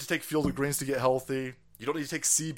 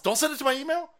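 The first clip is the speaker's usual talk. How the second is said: exasperated plea; 12 semitones higher, 4 dB louder, faster than usual. Real, not acted. Pleading with clinic, repeated.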